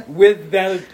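ordinary talk

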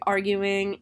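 ordinary delivery, nothing marked

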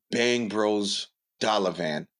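usual speaking voice

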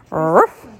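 low, punchy ruff; a quick dog bark; puppy ruff. A narrator's reading. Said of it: "went Willie".

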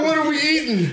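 high, nosy mock question; mocking coworker voice.

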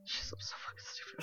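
hushed whisper to herself; a breathy self-scolding whisper; whispered aside. Real, not acted.

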